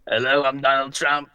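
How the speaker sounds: low mocking copy of an impression; Donald Trump. Caller mocking the host's try, low Trump voice. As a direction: mimic a poor presidential impression. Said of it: "That's what it sounds like".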